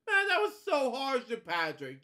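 loud, high exclamation of dismay; appalled outburst. Real, not acted. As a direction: burst out high, then slide down in pitch. Reader exclaims at harsh story event.

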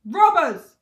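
very loud, high bellow. A narrator's reading. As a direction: bellow it as a furious accusation. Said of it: "bellowed the fat king".

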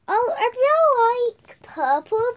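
slow gushing. Real, not acted. Gushing over purple.

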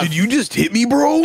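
put-on tough-guy challenge; fighting voice. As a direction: square up for a fight. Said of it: "why do you sound like that".

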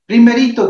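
Primerito called out high and loud; stressed first word. Shown as a demonstration. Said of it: "énfasis de profesora". (Primerito, agudo y fuerte)

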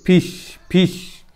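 फिश repeated as a separate, deliberate word; short I modelled. Shown as a demonstration. Teacher drilling students, फिश repeated deliberately. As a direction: say फिश twice, pausing between. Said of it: "ई असा उच्चार होतो".

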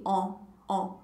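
"On" said with rounded lips, nasal and soft. Shown as a demonstration. Teacher shows the rounded mouth for "On", softly. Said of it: "A boca fica arredondada".

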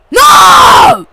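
high, loud anguished no; furious shout. Real, not acted. Gamer losing the match, real loud anguished shout. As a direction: scream it as the game slips away.

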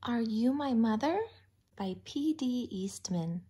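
usual speaking voice

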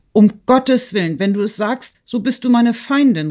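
high, urgent exclamation; alarmed cry. A narrator's reading. Woman begging her friend to keep the secret, alarmed cry. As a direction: cry out in protest, pitch jumping up. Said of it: "rief Natalie".

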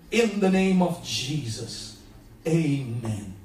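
pastor praying over the house; prayer. Acted. Leads a prayer.

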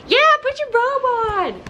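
high, loud urging; playful shout. Real, not acted. Vlogger shouting at Erin to put her robe on, playful.

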